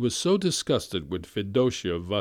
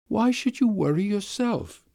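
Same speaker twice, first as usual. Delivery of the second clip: put-on higher, tearful young woman's voice; governess; 12 semitones higher than usual. Governess answering her master, higher and tearful.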